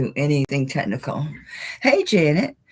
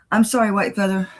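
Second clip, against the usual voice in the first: usual speaking voice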